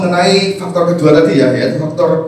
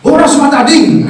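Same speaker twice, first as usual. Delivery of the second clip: higher, shouted Batak goodbye; mock Batak shouting farewell; 7 semitones higher, 3 dB louder than usual. Preacher imitates a Batak goodbye shouted in a high voice. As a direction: yell the goodbye Batak style. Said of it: "nada teriak gitu loh mereka".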